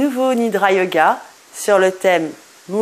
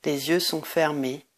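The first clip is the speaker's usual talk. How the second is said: guided meditation; 4 semitones lower, 8 dB quieter than usual. Acted. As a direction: guide listeners into deep rest, lower and much softer. Leads a Nidra Yoga meditation.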